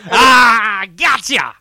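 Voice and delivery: big, high, loud "gotcha" yell; sudden shout. Acted. Co-host finishing his joke about the delayed burst, shouting.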